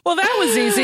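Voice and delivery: high, chirpy ad-voice take on the Staples easy button; a lightly mocked commercial tagline. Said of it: "like the Staples easy button".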